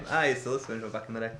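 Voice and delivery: throwaway mutter; quiet aside. Shown as a demonstration. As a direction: mumble it quickly under your breath. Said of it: "repeats it low and fast".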